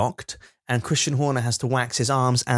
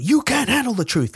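movie quote shout; 11 semitones higher than usual. Retold or imitated. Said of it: "as you know from the movie".

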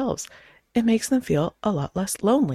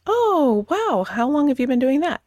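high, bright-eyed mock interest, a little louder; eager small talk; 8 semitones higher, 4 dB louder than usual. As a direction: play the overly keen chat partner. Acted small-talk partner, delighted.

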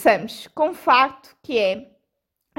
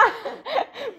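fake laugh; 12 semitones higher, 4 dB quieter than usual. Real, not acted. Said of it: "Este riso pareceu mesmo fake".

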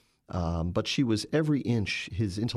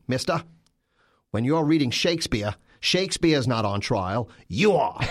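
imitating a stern Boston Irish priest, higher and louder; Boston Irish brogue; 4 semitones higher, 5 dB louder than usual. Father Tom Matthews scolding a wise guy in class, mock Boston Irish brogue. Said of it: "in that thick Boston Irish brogue".

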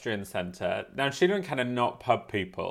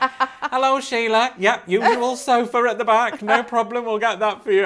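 much higher and louder, bright welcoming hostess voice; chirpy restaurant hostess; 10 semitones higher, 5 dB louder than usual. Retold or imitated. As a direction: play the friendly booking lady greeting a regular. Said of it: "And the woman's like".

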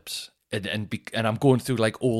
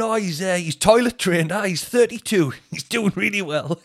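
Geordie mate; 9 semitones higher, 5 dB louder than usual. Retold or imitated.

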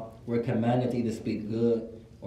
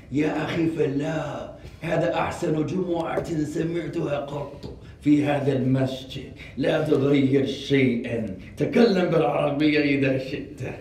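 put-on old sheikha voice. Old woman praising the imam's Jumu'ah, sheikha voice. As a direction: speak as an emphatic old sheikha. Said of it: "She has a sheikha voice".

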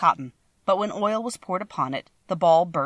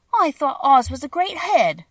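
put-on high, slow little girl voice; lightly voiced young girl; 9 semitones higher, 7 dB louder, slower than usual. A narrator's reading. Dorothy voiced in story.

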